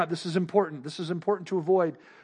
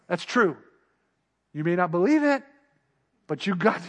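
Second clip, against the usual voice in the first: ordinary talk, nothing marked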